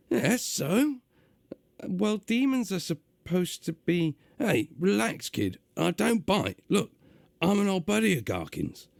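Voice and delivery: gruff, high London demon voice; gruff London accent. Acted. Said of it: "doing a gruff, uh, London accent".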